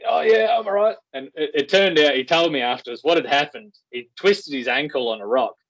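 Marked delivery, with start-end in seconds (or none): 0.1-1.0 imitating strained mate. Injured mate answers.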